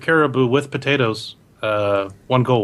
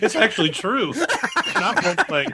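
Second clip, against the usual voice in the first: laughing; 10 semitones higher than usual. Real, not acted. Laughing after Gollum bit.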